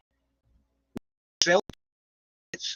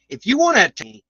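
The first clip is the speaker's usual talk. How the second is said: heated challenge; 5 semitones higher, 6 dB louder, faster than usual. Real, not acted. Angry rant at online attackers.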